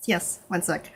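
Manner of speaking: usual speaking voice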